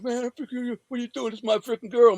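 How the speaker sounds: high mock-pleading voice; imitated protesting man. Beaten man protesting about his girl, high imitated plea. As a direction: whine the protest up high, as him.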